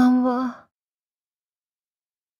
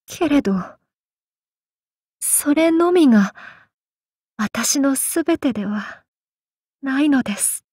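pleading; 3 semitones higher, faster than usual. Acted. Pleading to master.

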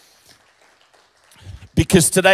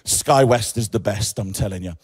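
airline ad line; 7 semitones lower than usual. Pretend. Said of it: "that's my other part-time job".

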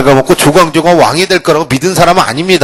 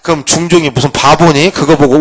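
normal talking voice

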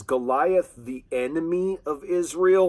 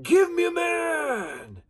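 very high, long-held yell; slow, stretched challenge cry; giant crying out; 12 semitones higher, slower than usual. Acted. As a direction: yell it across a canyon. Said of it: "that giant voice crying out".

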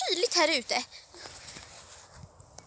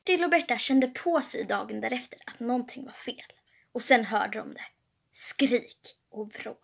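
low, hushed suspense narration; spänningsberättare; 6 semitones lower, 9 dB quieter than usual. A narrator's reading. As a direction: narrate ominously, low and soft. (låg, dämpad och ödesmättad berättarröst)

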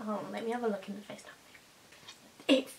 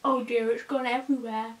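speaking with paste in mouth; talking around paste-coated teeth; mouth full of toothpaste; 4 dB louder than usual. Real, not acted.

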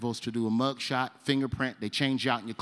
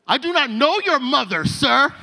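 high, loud formal protest; panicked denial; 12 semitones higher, 7 dB louder than usual. Retold or imitated. Scared new inmate to cell seven, high loud denial. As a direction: call out a high, desperate denial.

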